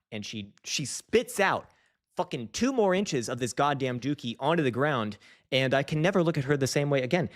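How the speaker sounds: usual speaking voice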